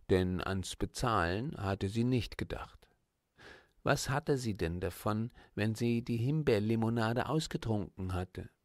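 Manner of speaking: ordinary delivery, nothing marked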